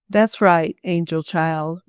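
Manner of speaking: plain reading voice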